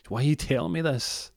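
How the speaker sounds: high-pitched mock exasperation; exasperated girlfriend. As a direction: cut in as the baffled girlfriend. Girlfriend interrupts his confession.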